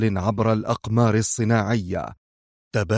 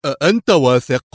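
louder, higher stern challenge; sterner; 4 semitones higher, 6 dB louder than usual. A narrator's reading. Yuri doubting the general's report, sterner. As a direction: demand it sternly. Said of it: "سأله يوري في صرامة أكثر".

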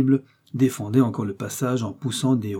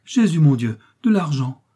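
loud, raised cry of dismay; dismayed exclamation; 5 semitones higher, 7 dB louder than usual. A narrator's reading. Farmer's wife ordered to hand over money, dismayed cry. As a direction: exclaim in alarmed disbelief. Frightened woman faced with demand for money.

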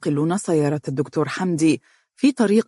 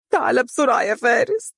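much higher tearful plea, rising on بسرعة; crying; 7 semitones higher than usual. Acted. Umm Faris begs her son on the phone, crying and rising. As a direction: beg him to hurry through sobs. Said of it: "وهي تبكي قائلة".

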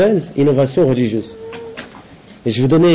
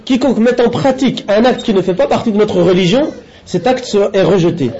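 higher-pitched, measured read-aloud in French; deliberate lesson-reading voice, raised in pitch; formal translation reading; 5 semitones higher than usual. Acted. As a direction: read out a translated religious text, phrase by phrase. Reads out French translation of text.

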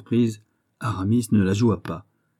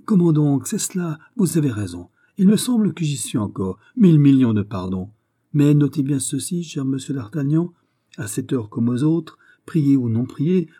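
high-pitched, eager host; flustered, over-obliging governor; effusively apologetic governor; 5 semitones higher, 4 dB louder than usual. Acted. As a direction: gush apologies, then settle into welcome. Baisemeaux suddenly remembers and apologises profusely.

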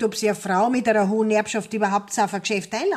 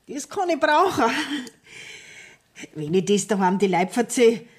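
normal talking voice

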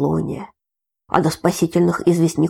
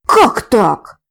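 startled; 4 semitones higher, 7 dB louder than usual. A narrator's reading. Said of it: "вскинулся дед".

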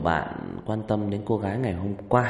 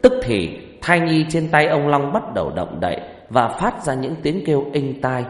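story narration; 4 dB louder than usual. A narrator's reading.

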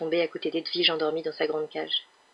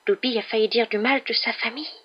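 high little creature voice; pitched-up elf, confessing; house-elf, distressed; 5 semitones higher than usual. Acted. Elf nearly spoke ill of family, high distressed voice.

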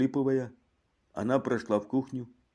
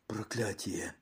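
dazed, barely audible; mechanical repeat; 9 dB quieter, slower than usual. A narrator's reading. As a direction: repeat it blankly, almost no voice. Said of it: "машинально повторяя".

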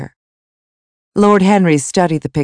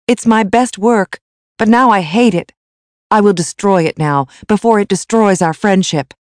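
higher, agitated declaration; upset resolve; 6 semitones higher, 4 dB louder than usual. A narrator's reading. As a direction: declare it with bitter determination. Basil angrily threatens his own portrait.

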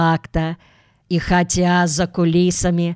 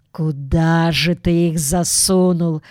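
usual speaking voice

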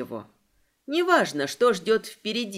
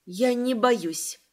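ordinary delivery

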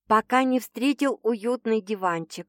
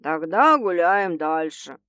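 low, slow, sulky grumble; gloomy grumble; 4 semitones lower, slower than usual. A narrator's reading. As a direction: grumble it darkly and slowly. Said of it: "мрачно проворчал мужчина".

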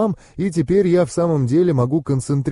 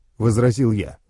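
usual speaking voice

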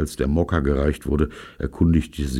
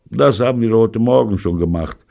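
higher, louder casual remark; offhand; 6 semitones higher, 6 dB louder than usual. A narrator's reading. Gangster casually admitting theft to his hosts, offhand. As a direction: toss it off casually. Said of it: "sagte Pirolo leicht hin".

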